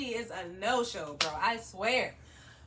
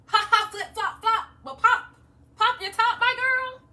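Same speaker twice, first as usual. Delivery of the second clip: mocking the stylist; 8 semitones higher, 5 dB louder than usual. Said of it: "And she was like".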